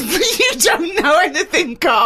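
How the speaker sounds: high, slow laughing scoff; laughing through the words. Real, not acted. Laughing at Carl.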